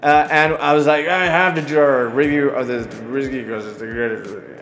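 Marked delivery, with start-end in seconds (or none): 1.1-4.5 mock slurred drunk insistence; a light hint of drunken rambling; imitating his drunk self. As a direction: lightly slur it like a tipsy man. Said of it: "that's what I sound like when I'm drunk".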